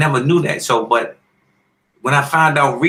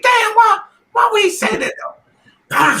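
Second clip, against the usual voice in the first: offended shout. Retold or imitated. After hearing Holyfield insult him, shouting offended. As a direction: bark out the hurt disbelief. I'm mad.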